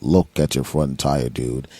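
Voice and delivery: low, slow, spooky dude voice; deep, dragged-out warning from nowhere; mysterious stranger. Retold or imitated. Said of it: "went up to the next person and went".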